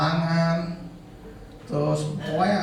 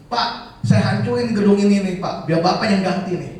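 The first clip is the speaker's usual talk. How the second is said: loud, raised-pitch imitation of a furious driver; retold angry threat; 5 semitones higher, 7 dB louder than usual. Angry driver threatening the organiser over unpaid fare, retold loud threat. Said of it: "dia bilang, ngancem-ngancem".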